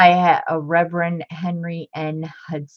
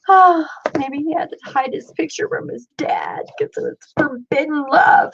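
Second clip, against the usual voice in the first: romantic excitement; 10 semitones higher than usual. Real, not acted. Imagining forbidden love story.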